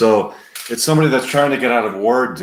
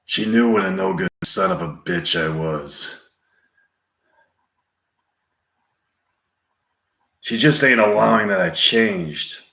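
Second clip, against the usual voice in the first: Clint Eastwood. Acted. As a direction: confide a hard past, with long pauses. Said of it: "Clint Eastwood continuing".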